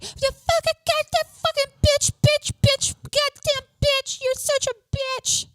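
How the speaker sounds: very high, shrieking impression of a furious woman; mock screaming Angela. Angela yelling at Haley, hammed-up high shriek. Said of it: "Angela was like".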